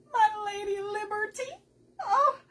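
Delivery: high, loud gasp of delight; delighted squeal. Real, not acted. Crafter showing off her Lady Liberty to viewers, squealing. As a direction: coo over the gift. Shows second gift.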